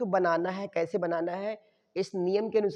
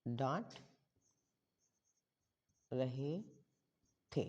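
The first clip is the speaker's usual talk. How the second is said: slow dictation; 7 semitones lower, 13 dB quieter, much slower than usual. Shown as a demonstration. Spells phrase out while writing.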